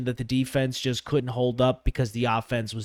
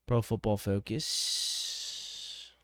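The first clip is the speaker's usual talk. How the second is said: drawn-out, softer Philly accent slip; Philly-sounding accent; 6 dB quieter, much slower than usual. Real, not acted. Podcaster slipping while reading a box score, Philly accent. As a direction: stretch it out with a Philly twang. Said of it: "Just sounded like a".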